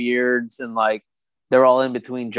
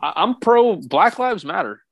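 much higher, put-on smug dude voice; mock a bro showing off his politics, pitched way up; mock woke hipster; 6 semitones higher than usual. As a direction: parody of a self-righteous hipster boasting. Mocking performative liberal hipster.

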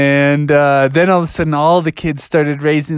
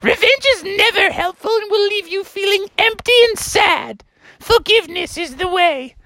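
high, dismissive teacher goose; Mother Goose. Acted. Teacher rejects the mouse's answer, high indignant goose. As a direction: scold in a high falsetto. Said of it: "And she was like".

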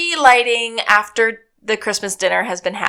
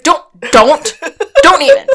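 louder, higher, laughing through it; mock scolding laugh at Sean; laughing don't; 6 semitones higher, 8 dB louder than usual. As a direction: swat it down while cracking up. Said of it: "He's like".

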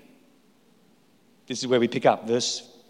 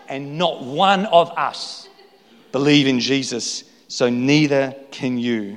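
ordinary talk

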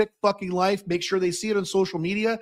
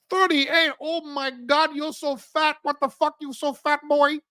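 much higher mock Chinese accent, incredulous; mock Chinese shopkeeper; 9 semitones higher than usual. Shopkeeper mocking the tourist's belt size, high mock Chinese accent. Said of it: "He goes".

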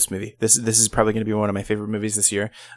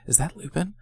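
put-on higher, softer childlike question; daughter's voice; 6 semitones higher, 7 dB quieter than usual. Father imitates his daughter asking about the actor, higher softer voice. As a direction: voice the young daughter asking in a small voice. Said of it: "She's like".

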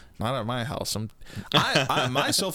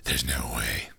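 breathy hero whisper; 13 semitones lower than usual. Acted. The retired hero refusing, in a whisper. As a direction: whisper it, defeated.

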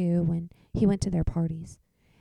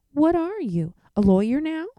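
exasperated; 9 semitones higher, 10 dB louder than usual. Acted. Mom exasperated.

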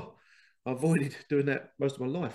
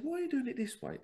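much higher, innocent question; naive young self; faster than usual. Retold or imitated. Young worker quizzes old colleagues, high and innocent. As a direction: ask it like a keen young apprentice. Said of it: "I said".

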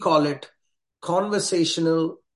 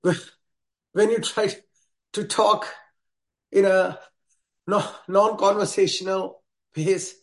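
slow, gasping, out of breath; breathless; 3 semitones higher, slower than usual. Shown as a demonstration. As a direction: talk like you're panting mid-run. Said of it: "this is how it'll sound".

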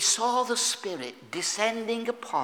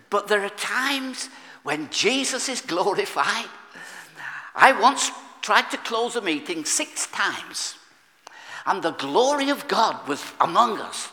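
preaching at full force; 4 semitones higher, 4 dB louder than usual. Acted.

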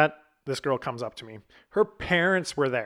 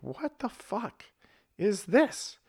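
high-pitched, hushed, drawn-out incredulity; retold disbelief; 7 semitones higher, 4 dB quieter, slower than usual. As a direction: stretch it out high and soft, stunned. Retold shocked reaction to seeing her.

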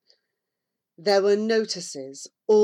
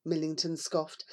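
usual speaking voice

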